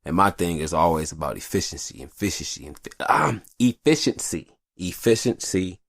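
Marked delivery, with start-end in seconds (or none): none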